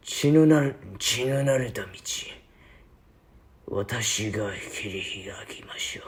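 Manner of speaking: lightly voiced game villain in Japanese; murmured menace swelling, then sinking to a whisper; Hubert impression. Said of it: "he says, uh, during his t-, post time skip".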